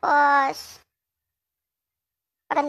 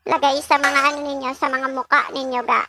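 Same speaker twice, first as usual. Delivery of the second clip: ordinary talk, nothing marked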